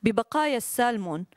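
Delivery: brisk Arabic reading of her own prose; literary reading. Acted. Author reads her story to the audience, brisk reading. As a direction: read your story aloud to the audience.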